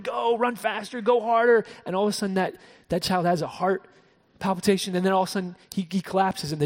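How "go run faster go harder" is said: higher, louder coaching yell; mock sideline shout urging a son to run; dad yelling. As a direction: push the runner on like a sideline dad. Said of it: "and he's yelling".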